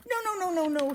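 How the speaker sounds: high, rapid string of reassurance; urgent protest. A narrator's reading. Cork stops Fuzz from going home, urgent. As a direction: hurry to stop a friend, hammed up.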